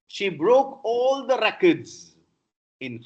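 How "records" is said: "records" as REC-ords; noun stress. Shown as a demonstration. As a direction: stress the first syllable of "records". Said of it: "stress moves from first to the second".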